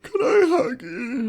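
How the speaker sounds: very high, loud, slow squeal of a plea; hammed-up begging. Joining the joke, squealing Jack's hug request very high and loud. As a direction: beg for the hug at the top of your voice. Friends trading the joke line.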